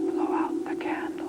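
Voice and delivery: whispered spirit voice; ghostly whisper. Acted. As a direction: breathe it like a voice from beyond. Spirit answers at seance.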